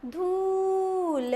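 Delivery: फूल very slow with the oo held and leaned on; long strong oo. Shown as a demonstration. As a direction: stretch and push the oo in फूल. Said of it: "जोर लगा के बोला".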